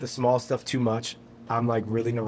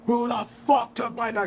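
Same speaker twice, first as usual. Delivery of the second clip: imitated furious scream at reduced volume; retold scream; 9 semitones higher than usual. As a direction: act out screaming at a crowd, volume held back. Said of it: "I start screaming at these people".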